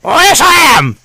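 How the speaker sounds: put-on high, reedy old gnome voice, henpecked; old gnome mishearing superior as wife, high and fretful; old gnome lord. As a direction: whine it up high like a fussy old lord avoiding his wife. Gnome answers.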